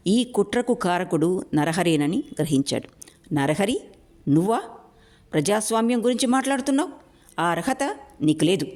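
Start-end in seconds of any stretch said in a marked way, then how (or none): 3.3-8.7 high, fast ringing challenge. Acted. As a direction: ring out the challenge. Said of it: "Shekhar కంఠం ఖంగున మ్రోగింది". (ఖంగున మ్రోగే కంఠం)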